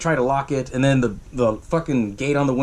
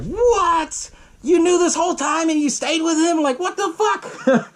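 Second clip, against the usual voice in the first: incredulous outburst; slower than usual. Real, not acted. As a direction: exclaim in disbelief, pitched way up. Teller reacts incredulously to wife's admission.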